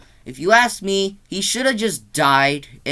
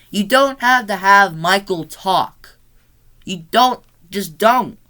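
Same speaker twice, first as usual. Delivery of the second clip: louder, higher, emphatic protest; exasperated insistence; 5 semitones higher, 6 dB louder, slower than usual. Real, not acted. Reviewer objecting to Michael talking, exasperated insistence. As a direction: plead firmly, leaning on each word.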